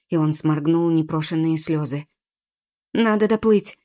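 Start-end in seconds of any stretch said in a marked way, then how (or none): none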